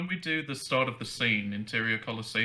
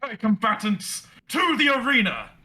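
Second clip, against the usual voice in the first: barbarian calling out, higher and louder; high-pitched barbarian voice; 9 semitones higher, 4 dB louder than usual. Acted. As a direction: summon the fighters like a booming barbarian. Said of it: "your high-pitched Herc-, or barbarian voice".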